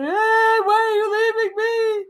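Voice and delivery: high, slow, pleading whine; clingy partner voice. Retold or imitated. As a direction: beg like an abandoned lover. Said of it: "You're gonna be".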